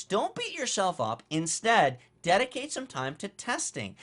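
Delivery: ordinary talk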